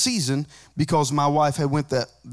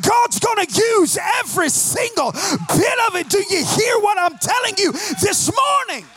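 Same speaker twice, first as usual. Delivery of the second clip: full-force preaching; 12 semitones higher than usual. Real, not acted. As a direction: preach it to the rafters, building. Preacher builds to full-force climax.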